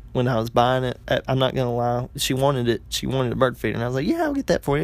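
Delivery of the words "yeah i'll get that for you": very high-pitched, put-on willingness; falsely agreeable. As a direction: agree sweetly while privately disagreeing, voice way up. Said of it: "In the back of my mind".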